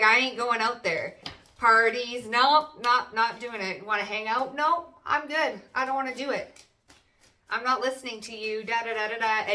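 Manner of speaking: sing-song tune-out; mock stubborn refusal. Reader voicing the hermit turning down parties, mock stubborn. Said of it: "It's like".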